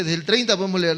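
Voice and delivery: ordinary talk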